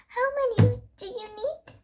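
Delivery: high, friendly shopkeeper voice; a kind older lady asking sweetly; bakery lady. A narrator's reading. Lady character asks.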